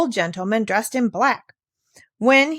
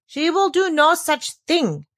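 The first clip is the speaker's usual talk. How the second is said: calm determination; 9 semitones higher, slower than usual. A narrator's reading. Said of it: "with calm determination".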